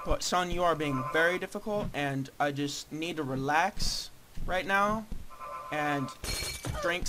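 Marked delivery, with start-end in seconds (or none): none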